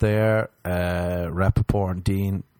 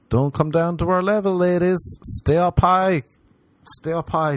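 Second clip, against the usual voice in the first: high pleading; 9 semitones higher, 4 dB louder than usual. Acted. Mock pleading to women.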